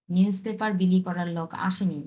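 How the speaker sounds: usual speaking voice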